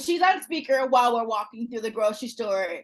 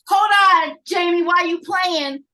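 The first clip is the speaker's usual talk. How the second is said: louder, slow mock challenge to the boyfriend; mock confrontation; 5 semitones higher, 7 dB louder than usual. Mom calling out the boyfriend, slow and louder. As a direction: call him out loudly, dragging it. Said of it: "Pretty much".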